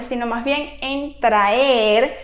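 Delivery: ordinary talk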